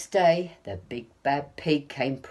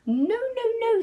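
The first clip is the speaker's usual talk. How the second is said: very high, loud frightened squeak; squeaky little wolves; 6 dB louder than usual. A narrator's reading. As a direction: squeal a panicked refusal. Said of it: "said the little wolves".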